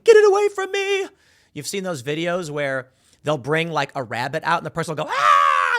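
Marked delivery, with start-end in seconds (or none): 0.0-1.1 loud, very high mock scream; panicked scream. Someone terrified of a stapler, mock screaming. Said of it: "they're crying and screaming". 5.1-5.8 loud hammed-up terrified scream; sudden yell of fake panic; mock scream. Person shown a rabbit, mock screaming. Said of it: "and start screaming".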